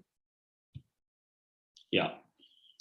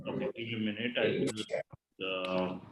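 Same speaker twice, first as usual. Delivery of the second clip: barely audible; 3 semitones lower, 12 dB quieter than usual. Real, not acted. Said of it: "Your voice is very low".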